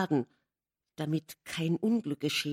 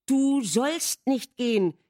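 higher, slow and emphatic; firm call; 6 semitones higher, 4 dB louder, slower than usual. A narrator's reading. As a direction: lay down the command so he cannot leave. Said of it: "rief sie mit großer Bestimmtheit".

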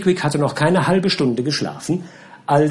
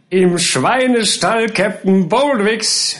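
higher, slow mocking taunt; jeering boys; 7 semitones higher, 3 dB louder, slower than usual. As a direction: jeer at the waking drunk. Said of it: "höhnte eine Menge neugieriger Buben". (höhnisch gerufen)

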